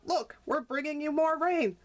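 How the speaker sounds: put-on high young frog voice; chirpy little frog calling.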